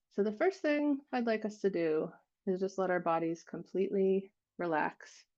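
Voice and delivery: unhurried meditation guide, long silences between phrases; guided meditation. Real, not acted. Leading listeners to relax and feel their hearts, guided meditation voice. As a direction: guide them gently, leaving space after each phrase.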